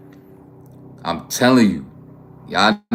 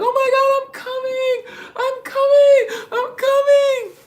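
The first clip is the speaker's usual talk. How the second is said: extremely high falsetto wail, words stretched out; imitating screaming woman; slower than usual. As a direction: squeal it slowly as a mock woman in ecstasy. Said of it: "while she taking that shit like".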